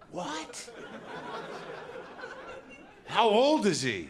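mock shock. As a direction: gasp in disbelief as a child. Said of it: "You're like".

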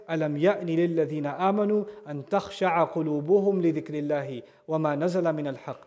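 rapid recited Arabic verse; Quran recitation. Acted. Preacher reciting a verse to the congregation, fast recitation. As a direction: recite the verse quickly and evenly.

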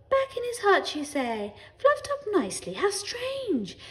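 high-pitched, overplayed wonder; feigned astonishment. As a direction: act baffled by the news. Parents act astonished.

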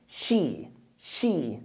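شي said soft with a long sh; drilling she. Shown as a demonstration. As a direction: repeat شي gently, stretching the sh. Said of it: "أقول عليها شي". (تكرار شي بهدوء)

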